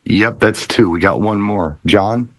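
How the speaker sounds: ordinary talk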